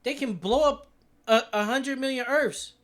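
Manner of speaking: high, loud astonished rant; stunned by the numbers; excited disbelief. Real, not acted. Streamer astonished at claim.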